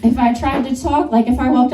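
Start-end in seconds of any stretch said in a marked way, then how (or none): none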